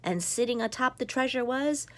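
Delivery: usual speaking voice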